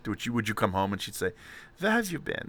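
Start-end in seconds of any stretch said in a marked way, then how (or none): 1.8-2.5 high, soft put-on voice of a strict mother; stringent German mother. Host imagining mother greeting child coming home, mock stringent German mother. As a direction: play the strict German mom, pitched up. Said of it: "Was she stringent?".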